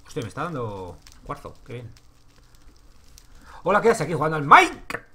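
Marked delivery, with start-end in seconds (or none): 3.6-5.1 loud teasing call, pitch up; loud jokey yell at a viewer; playful shout. Real, not acted. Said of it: "Uy, no puedo gritar".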